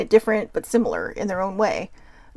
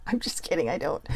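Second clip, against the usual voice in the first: whispered aside; 4 semitones lower, 8 dB quieter than usual. Real, not acted. Joking aside after a quip.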